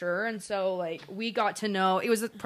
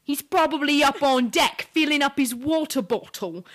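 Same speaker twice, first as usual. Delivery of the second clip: higher-pitched British impression; put-on English accent; 4 semitones higher, 4 dB louder than usual. Shown as a demonstration. Sister proving she is good at accents, put-on English accent. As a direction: show off your English accent. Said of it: "I'm pretty good at accents".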